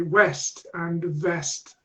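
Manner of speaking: slow, careful west and vest; drawing out "west" and "vest" apart; slow minimal pair. Shown as a demonstration. Teacher modelling "west" and "vest" slowly. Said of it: "difficulty distinguishing between".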